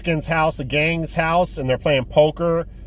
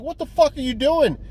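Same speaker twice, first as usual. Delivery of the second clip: put-on jealous boyfriend snapping, pitched way up; jealous yell; 9 semitones higher than usual. Jealous boyfriend confronting the guy, high outraged yell. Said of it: "He's like".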